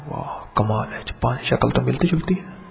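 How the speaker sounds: soft, awed murmur at his own reflection; hushed amazement. Acted. The young lion sees his reflection in the water in hushed wonder. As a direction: marvel quietly, as if you can't believe it.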